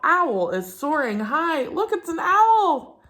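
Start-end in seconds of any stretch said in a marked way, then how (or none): 1.7-3.0 excited owl. Real, not acted. Excited discovery for children.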